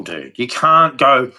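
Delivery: ordinary talk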